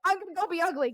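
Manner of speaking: ordinary talk, nothing marked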